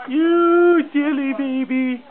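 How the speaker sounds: high, slow sing-song coo. Real, not acted. Dad coos at toddler.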